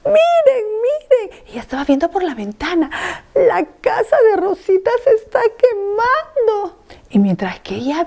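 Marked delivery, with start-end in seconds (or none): 0.1-1.4 put-on frightened child. 3.4-6.8 put-on frightened child. Child sees house burning.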